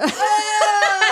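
burst of loud laughter. Real, not acted. Big laugh after punchline.

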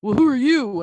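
loud, high snotty comeback; cheeky retort. Retold or imitated. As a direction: talk back like a rude kid. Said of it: "And they go".

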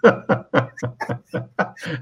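real, sustained laugh. Real, not acted. Guest amused by a co-host's impression, laughing.

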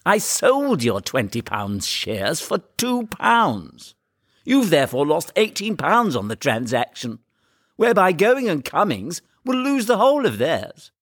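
slow, irritated explaining; put-on young man's voice, testy and drawn out; irritable. A narrator's reading. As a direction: spell it out with strained patience. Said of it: "Lupin said quite irritably".

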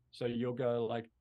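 normal talking voice

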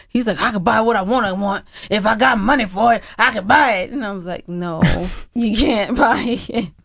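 ordinary delivery

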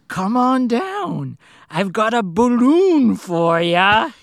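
sing-song sinister clown greeting; creepy clown voice. Retold or imitated. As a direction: lure him in like a creepy clown. Voicing the sewer clown.

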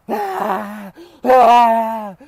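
loud high mock screams; two sharp put-on screams; retold screams. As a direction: shriek it out as if being choked. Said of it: "So I'm like".

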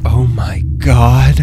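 slow, stretched-out horrified shout. Acted. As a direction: gasp it out in horror. Horrified by the monster.

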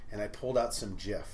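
normal talking voice

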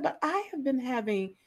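mildly voiced friend, drawn out slowly; a slow list of complaints, lightly acted; a touch of weary complaining; imitating a friend. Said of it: "She said".

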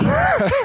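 a high, sudden burst of laughter at himself; embarrassed laugh. Real, not acted. Embarrassed at the coach's answer, high burst of laughter. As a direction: laugh out at your own blunder. Embarrassed at his own gaffe.

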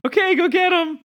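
very high, loud character voice; Vilma. Acted. Vilma from behind Sir Frederick, sending him in, high and loud. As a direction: cheer him on in a high squeaky shout. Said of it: "Vilma also stands behind Sir Frederick".

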